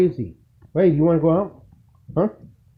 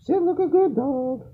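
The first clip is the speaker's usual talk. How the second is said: much higher coaxing voice on good dog; high pet-talk command; 11 semitones higher than usual. Real, not acted. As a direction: coax it up high like talking to a puppy.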